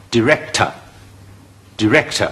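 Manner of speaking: director頭一音節讀短I音，似di; 慢慢示範director，開頭用短I、尾音弱讀; 短I音示範. Shown as a demonstration. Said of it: "佢用一個E". (教學式讀director，di音短促)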